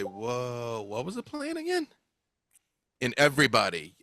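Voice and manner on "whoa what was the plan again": clueless androgynous voice, rising sharply; confused, lilting question. Acted. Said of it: "that first androgynous voice say".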